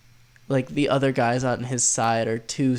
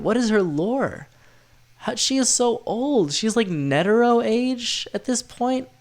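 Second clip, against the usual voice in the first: excited; 9 semitones higher, slower than usual. Real, not acted. Gushing about a mysterious character.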